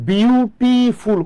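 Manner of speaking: Beautiful stretched into three beats; slow, counted syllables on beautiful. Shown as a demonstration. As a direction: draw out beautiful as বিউ, টি, ফুল. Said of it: "কতবার লাগে বলেন তো".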